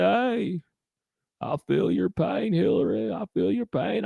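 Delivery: a hint of Gump pitch, pleading; Forrest Gump Clinton fusion. Gump-Clinton telling Hillary he feels her pain, lightly voiced. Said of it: "good fusion".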